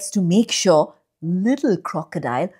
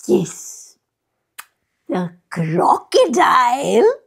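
little crocodile voice; 3 semitones higher than usual. Acted. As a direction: sound small and eager.

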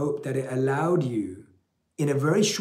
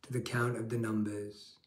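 guided meditation; 2 semitones lower, 6 dB quieter than usual. Acted. Leads a guided breathing meditation.

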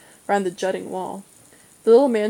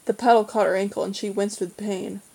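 ordinary delivery

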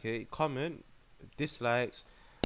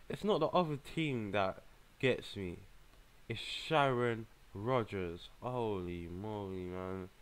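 ordinary delivery

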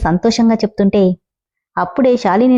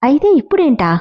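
higher, shocked disbelief; a startled, voiced-up retort; 6 semitones higher than usual. A narrator's reading. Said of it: "Shalini shock అయి". (Shalini shock అయి, ఆశ్చర్యంగా)

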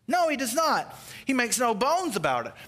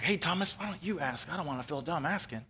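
hushed, quick nudge to a friend; furtive aside; 3 semitones lower, 7 dB quieter than usual. Retold or imitated. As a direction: drop the volume, sheepishly pass the question on. Imitated furtive aside to Thomas.

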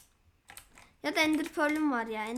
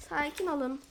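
ordinary talk

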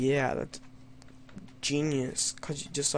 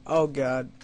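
ordinary talk, nothing marked

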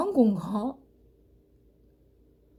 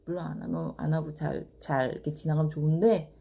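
normal talking voice

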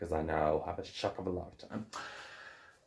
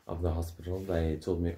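ordinary talk

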